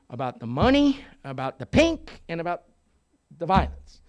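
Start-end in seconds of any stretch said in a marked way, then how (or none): none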